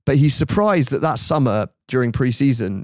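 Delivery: ordinary talk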